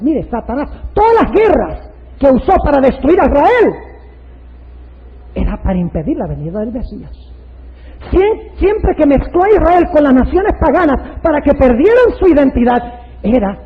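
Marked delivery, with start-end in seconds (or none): 0.9-3.7 loud, high preaching delivery; forceful preaching. Real, not acted. 8.1-12.8 loud, high preaching delivery; forceful preaching. Real, not acted.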